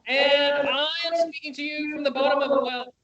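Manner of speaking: echoey, bottom-of-a-well sound; time-portal echo. Real, not acted. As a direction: call out through a distant echo. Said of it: "sounds like you went through a time portal".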